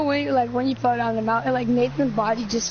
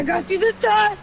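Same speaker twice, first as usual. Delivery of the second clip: gloating taunt; 7 semitones higher than usual. Acted. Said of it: "echoing the taunt".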